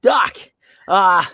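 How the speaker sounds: high, loud raspy shout of "Doc"; raspy high Marty calling Doc; Michael J. Fox impression. Shown as a demonstration. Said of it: "little rasp in the back of the throat".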